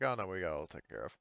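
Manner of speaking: ordinary talk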